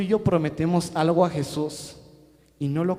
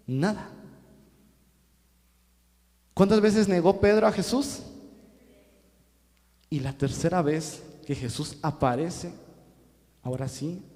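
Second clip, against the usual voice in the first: normal talking voice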